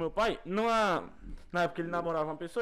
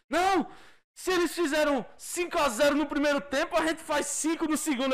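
overconfident fan; 6 semitones higher than usual. Retold or imitated. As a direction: sell the comeback with blind hope. Quoting inconvenient optimist relative.

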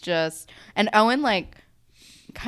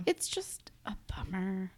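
soft unvoiced sigh of sadness; whispered, slow, real sadness; whispered bummer; 13 dB quieter than usual. Real, not acted. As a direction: whisper it, deflated. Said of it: "whispered sad remark".

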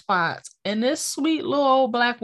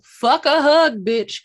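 quoting grandma; 5 semitones higher, 6 dB louder, slower than usual. Retold or imitated. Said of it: "Quote from the video".

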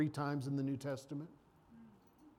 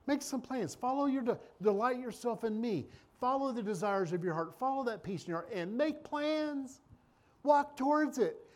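higher, urgent preaching as God's voice; voicing the Lord; 8 semitones higher than usual. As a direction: call it out high, like God urging you on. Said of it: "And now the Lord's saying".

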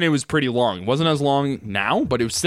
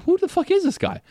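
incredulous retort. Retold or imitated. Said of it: "but I was just like".

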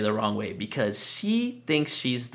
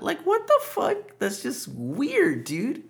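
very high, slow, strained disbelief; incredulous; 14 semitones higher, slower than usual. Real, not acted. Podcaster reacts to the mother's weird remark, incredulous. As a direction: squeak it out, weirded out.

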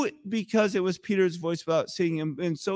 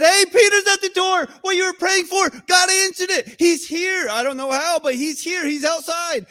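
put-on much higher, louder girlish voice bursting with news; excited little girl; 10 semitones higher, 5 dB louder than usual. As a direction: play Rhoda, thrilled Peter is at the door.

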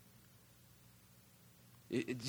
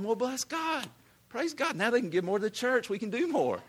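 much higher, bright rejoicing cry; joyful praise; 8 semitones higher than usual. Retold or imitated. Preacher models rejoicing at another's promotion, high and joyful. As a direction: lift it up, thrilled for them. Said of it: "Instead of just going".

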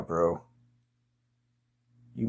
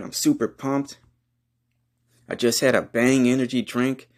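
normal talking voice

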